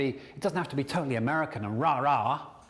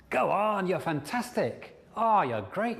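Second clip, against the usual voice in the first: high, encouraging cheer; cheering champion; 5 semitones higher, slower than usual. Acted. Coach plays a supportive inner voice, high and cheering. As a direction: urge them on like their biggest fan. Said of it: "That voice should be your champion".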